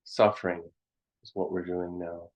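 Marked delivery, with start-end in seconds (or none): none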